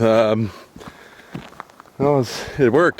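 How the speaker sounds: breathless, catching breath. Real, not acted. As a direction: still panting between words. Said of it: "I'm all out of breath".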